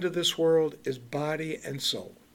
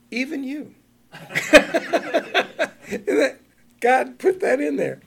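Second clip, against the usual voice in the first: laughing with delight; 9 semitones higher, 5 dB louder, slower than usual. Real, not acted. Delighted laugh over verse.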